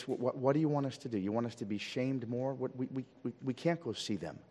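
soft, stammering objection; bewildered protest. Acted. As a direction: stumble over the words as baffled lepers would. Acts out bewildered lepers objecting.

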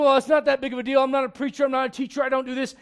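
high, loud whiny excuse. Pretend. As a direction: mimic a reluctant believer brushing it off. Mocking the reluctant believer's excuse.

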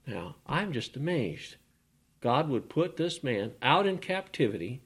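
usual speaking voice

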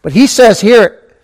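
loud, high, insistent preaching; full-force preaching. Real, not acted. Preacher pressing the point on his congregation, loud. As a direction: drive it home from the pulpit.

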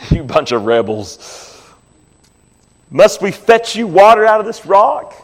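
a mild retold scolding, pitch rising on the question; angry Moses. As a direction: Moses scolding the rebels, half-acted, not shouted. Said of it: "He sounds angry".

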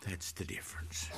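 whispered, very soft and low; hushed repeat. Real, not acted. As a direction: drop to a near whisper. Repeats point in a hush.

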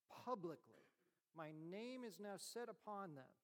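ordinary delivery

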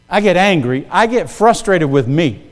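usual speaking voice

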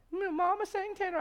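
mock high-pitched tenor voice; silly high angel. Preacher joking how an angel would not sound, silly high voice. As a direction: put on an absurdly high, unangelic voice. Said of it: "talking like that".

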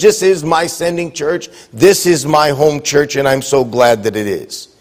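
ordinary talk, nothing marked

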